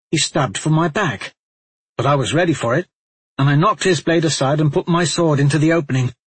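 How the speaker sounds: lightly voiced fierce warrior; intense Talmadge. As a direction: tell the fight with rising force. Talmadge finishes story.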